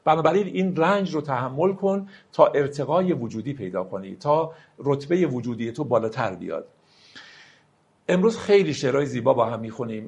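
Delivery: usual speaking voice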